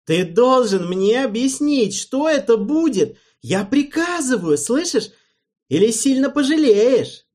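loud, high ordering voice; bully him with a threat; threatening command. A narrator's reading. Alvin orders and threatens Erald, furious.

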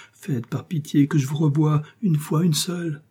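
beg, voice rising to the end; pleading. A narrator's reading. Lover begs to see her once more.

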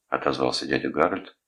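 plain reading voice

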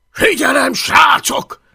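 plain reading voice

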